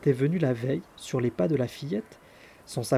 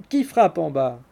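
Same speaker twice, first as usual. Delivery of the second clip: a sleepy maid's cross call, louder; angry; 6 dB louder than usual. A narrator's reading. Sleepy servant at window scolding the knocker, cross. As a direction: snap down from the window. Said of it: "demanda avec colère".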